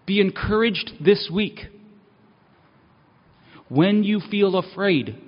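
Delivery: a steady, rhythmic call to take heart; preaching refrain. Acted. Pastor encourages the congregation, rhythmic and earnest. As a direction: lift the congregation with every repeat.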